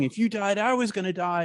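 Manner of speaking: high, distressed cry, imitated; retold crying. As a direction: imitate a frightened man crying out. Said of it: "he's crying and he's crying".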